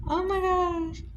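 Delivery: high, giggly oh my gosh; real delighted squeal; laughing disbelief. Real, not acted. Still laughing after the burn.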